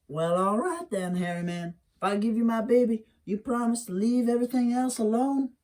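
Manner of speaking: a wary, questioning plea; Wiley's mammy. Acted. Mammy bargains.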